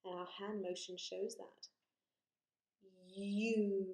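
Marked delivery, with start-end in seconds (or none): 3.1-3.9 U drawn out from a y glide into oo; long vowel U. Shown as a demonstration. Teacher shows the changing mouth, U stretched out. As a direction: hold the letter name U long. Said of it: "U is another long vowel sound".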